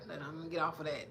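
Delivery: ordinary talk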